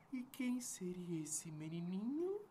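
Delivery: secretive whisper. Acted. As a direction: lean in and whisper. Magician whispers to boy.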